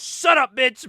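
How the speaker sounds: high, loud outburst; mock angry shout. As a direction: act out cutting someone off furiously. Angry boss silences the woman.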